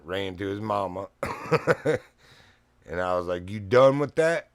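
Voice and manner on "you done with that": loud, high "you done with that"; much louder, higher retold challenge; stern challenge. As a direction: demand it like a warning to the dog. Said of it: "Like that".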